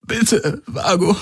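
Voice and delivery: high, broken whimper. A narrator's reading. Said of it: "Dann wimmerte er". (flehendes Wimmern)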